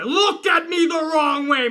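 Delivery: hammed-up pissed-off threat, escalating; mock wrestler rage, stretched out; Goldberging out. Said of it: "I'm Goldberging out".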